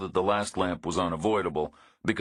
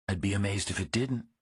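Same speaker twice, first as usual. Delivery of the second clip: a dry, wary woman, a touch higher; woman's dry voice; 3 semitones higher than usual. A narrator's reading. Woman complains to Reacher about no signal, dry and higher.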